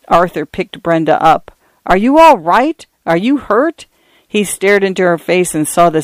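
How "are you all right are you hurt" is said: higher-pitched, urgent questions rising at the end; anxious, high rising questions; anxious concern. A narrator's reading. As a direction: check on a child, worried. Father checks fallen daughter after attack.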